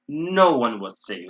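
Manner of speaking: slow, drawn-out "No one"; stress on No one. Shown as a demonstration. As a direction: lean heavily on "No one". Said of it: "I'm emphasizing this".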